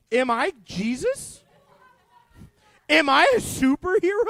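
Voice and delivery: amazed. Retold or imitated. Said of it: "you'd be like".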